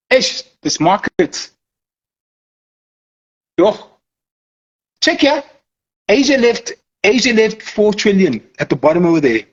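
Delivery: a hint of a hyped hustler pointing at the chart; lightly voiced trader showing his buddy the market; street-slang trader. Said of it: "don't you think they talk like that?".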